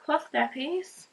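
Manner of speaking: put-on high, sing-song talk to a baby. Mum cooing to her newborn son, high baby talk. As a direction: coo at your little one.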